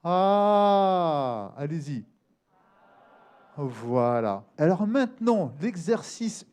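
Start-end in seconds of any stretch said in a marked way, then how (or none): 0.0-1.5 sustained middle-register "ah". Shown as a demonstration. Teacher showing students the intermediate zone, sustained middle voice. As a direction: hold one vowel placed behind the eyes. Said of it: "On va solliciter beaucoup cette zone".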